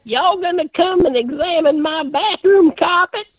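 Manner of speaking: very high-pitched mock Southern voice; Southern drawl. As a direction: put on a high, drawn-out Southern accent. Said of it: "It was a Southern drawl".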